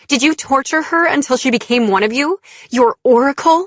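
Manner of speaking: high, loud, mounting questions; heated accusation. A narrator's reading. Heroine's questions escalate.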